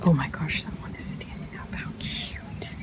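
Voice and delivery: hushed, fast delighted whisper; excited whisper. Real, not acted. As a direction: whisper so the mongoose stay. Whispering near mongoose so not scaring.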